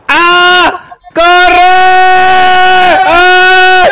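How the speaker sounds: high, loud terrified scream; panicked scream. Real, not acted. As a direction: scream while running for cover. Fleeing under fire, screaming.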